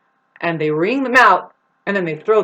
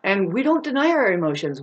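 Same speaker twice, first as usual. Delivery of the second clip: ordinary talk, nothing marked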